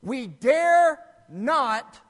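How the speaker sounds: WE DARE NOT, loud and drawn out; slow, forceful weight on DARE and NOT; emphatic warning. Real, not acted. Preacher hammers his key warning.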